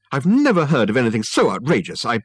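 loud, high outraged protest; indignant. A narrator's reading. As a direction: bluster in outrage. Son indignantly protests to mother.

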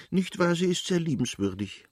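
put-on old countess.

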